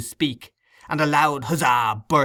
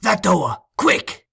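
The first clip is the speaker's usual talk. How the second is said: urgent order; 6 semitones higher, 4 dB louder, slower than usual. A narrator's reading. Sikes hurrying his gang.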